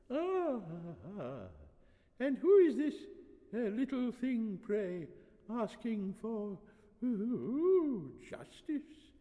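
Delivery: put-on high, halting old man's coo; delighted old priest. A narrator's reading. Old priest noticing the young girl, delighted doddering. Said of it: "The old man was delighted".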